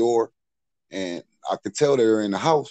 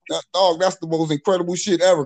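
higher-pitched incredulous protest; retold disbelief; 8 semitones higher, 3 dB louder than usual. Accused man hearing the made-up statement, retold disbelief. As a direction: reenact scoffing at an absurd accusation.

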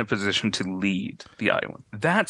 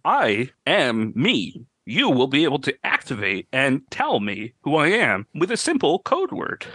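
changing shapeshifter voice; 3 semitones higher than usual. Acted. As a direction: let the pitch wander like a bad dub. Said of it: "his voice is, like, changing".

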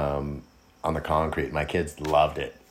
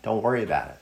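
mock reassurance; 9 semitones higher, faster than usual. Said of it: "And I was like".

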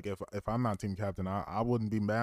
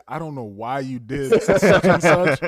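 Fabe; 5 semitones higher, 14 dB louder, slower than usual. Retold or imitated. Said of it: "just when you say".

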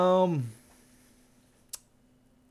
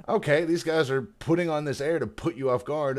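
realizing surprise; 5 semitones higher, 5 dB louder than usual. Retold or imitated. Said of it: "it's just like".

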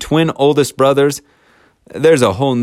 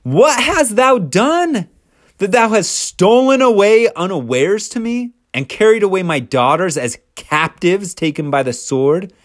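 livid father-in-law; 7 semitones higher than usual. Retold or imitated. Angry Laban scolding the son-in-law who fled. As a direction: demand it like a wronged father. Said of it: "is livid by this point".

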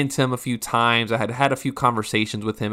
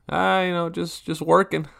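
awkward reply; 7 semitones higher than usual. Retold or imitated. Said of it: "that awkward giggle".

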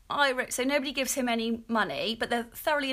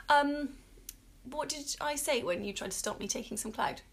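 usual speaking voice

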